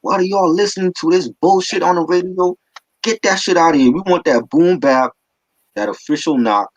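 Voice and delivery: high-pitched mock rant, settling toward the end; Primo, mad. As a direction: imitate a fed-up producer telling listeners off. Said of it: "Primo is mad".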